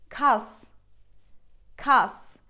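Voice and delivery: "kas" ending in a plain S; careful "kas" with a hissed S; clear final S. Shown as a demonstration. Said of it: "see the big difference between them".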